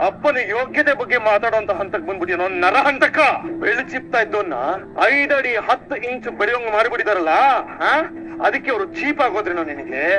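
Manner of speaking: high-pitched, outraged rebuke; indignant scolding. Acted. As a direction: scold him for disrespecting his father. Scolds son for insulting father.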